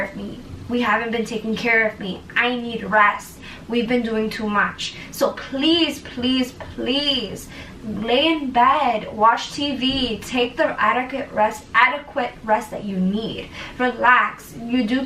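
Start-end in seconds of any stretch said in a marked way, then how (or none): none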